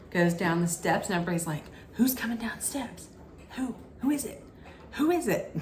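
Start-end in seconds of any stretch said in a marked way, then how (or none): 1.9-5.6 rising higher, eager questions; mock curious crowd. Contestants wondering who comes down the steps, eager. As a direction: play the nosy crowd craning to see.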